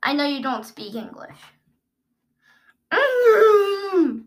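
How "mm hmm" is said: muffled through tape. Real, not acted. Mouth taped shut, humming.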